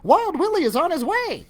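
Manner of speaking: high, pleased squeaky voice; high pleased squeak; satisfied squeak. A narrator's reading. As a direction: squeak it out, delighted. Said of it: "she squeaked with satisfaction".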